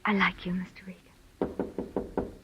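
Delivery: whispered, slowing right down on Mister Regan; flirty whisper. Acted. Assistant secretly flirting with the detective at the door, whispered. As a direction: breathe it to him like a secret.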